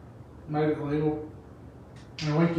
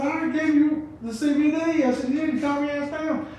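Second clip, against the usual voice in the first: high mock exasperated reply; 11 semitones higher than usual.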